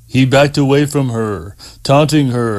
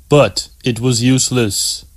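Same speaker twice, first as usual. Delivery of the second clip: usual speaking voice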